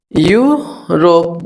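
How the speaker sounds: यूरोप said slowly as "yoo ... rop"; syllable by syllable. Shown as a demonstration. Teacher repeats the EU rule's example, यूरोप slowly split in two. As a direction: draw out यू in यूरोप, pause, then रोप. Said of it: "यू से पढ़ते हैं".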